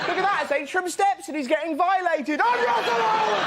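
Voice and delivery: high comic squeal building to a held shriek; hammed-up outcry. Comic exposed to the gathered crowd, hammed-up high outcry. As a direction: start high and climb to a mock wail. Acts out outcry as curtains open.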